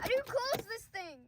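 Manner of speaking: high, loud exclamation; frustrated yell. Acted. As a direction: shout it in exasperation. Acted frustration with the door.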